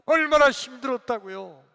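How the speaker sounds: loud, high protest to Jesus; whining complaint. Acted. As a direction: act out a worked-up grumble at full voice. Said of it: "이제 예수님께 투정하듯이 했습니다". (투정하듯 크고 높은 목소리로)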